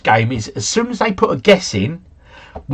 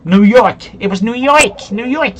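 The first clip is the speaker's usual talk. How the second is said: raised, thrilled exclamation; excited celebration; 6 semitones higher, 4 dB louder than usual. Real, not acted. Player celebrating a nearer New York guess, excited. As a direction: crow over the winning guess, pitched high.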